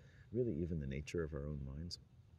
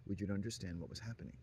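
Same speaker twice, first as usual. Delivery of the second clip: usual speaking voice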